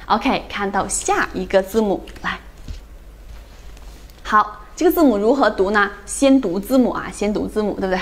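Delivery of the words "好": normal talking voice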